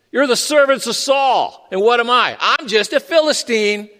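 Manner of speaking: a hint of Goliath jeering across the valley; taunting giant. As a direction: call out the taunt to the enemy camp. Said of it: "And he says".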